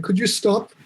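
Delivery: usual speaking voice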